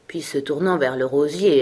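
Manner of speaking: plain reading voice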